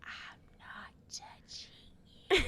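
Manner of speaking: voiceless, slow teasing whisper; slow mouthed whisper. Retold or imitated. Sister taunting brother again, slow silent whisper. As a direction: mouth it slowly, almost no sound. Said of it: "and I say".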